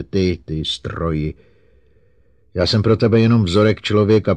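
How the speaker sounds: rising, wounded tirade; almost tearful outburst. A narrator's reading. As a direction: spill it out, hurt and accusing. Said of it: "vydrmolila ze sebe Susan Calvinová téměř plačtivě".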